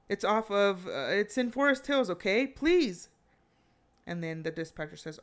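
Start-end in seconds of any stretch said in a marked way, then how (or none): 0.1-3.1 impatient, agitated plea for help; frantic. Retold or imitated. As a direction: imitate a flustered caller, louder and climbing in pitch. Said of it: "um, he's frantic".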